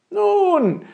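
very high, loud drawn-out opener; lanky fellow's high call. Acted. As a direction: holler it high and long. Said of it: "rief der".

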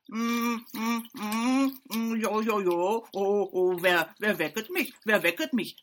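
loud, grumbling beaver voice waking up; sleepy beaver. A narrator's reading. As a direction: groan awake like a drowsy old beaver. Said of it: "fragte der Biber".

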